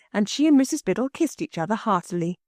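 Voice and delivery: ordinary delivery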